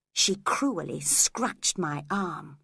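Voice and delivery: usual speaking voice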